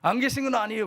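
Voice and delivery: mock confrontation with God, high and loud; defiant challenge. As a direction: imitate a believer talking back. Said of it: "하나님 앞에 대들고 막".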